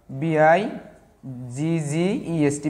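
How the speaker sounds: very slow, separated letters; spelling out. Shown as a demonstration. Teacher showing the doubled consonant in bigger, slow spelling out. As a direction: space out each letter for learners.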